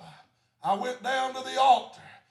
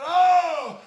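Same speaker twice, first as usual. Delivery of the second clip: joyful oh; 5 semitones higher, 4 dB louder than usual. Retold or imitated. Threw his hands up.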